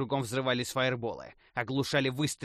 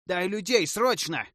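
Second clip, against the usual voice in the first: loud, high desperate yell; 7 semitones higher, 6 dB louder than usual. A narrator's reading. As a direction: yell for help. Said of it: "вопил эльф".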